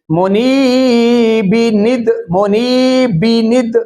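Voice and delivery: मुनीबिनिद chanted slowly, long "nee", short "bi-nid"; linked vowel demonstration. Shown as a demonstration. Teacher showing the vowel under noon, मुनीबिनिद in slow linked chant. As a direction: hold the "nee", then link "bi-nid" in मुनीबिनिद. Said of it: "नून के नीचे जाकर पढ़ेंगे".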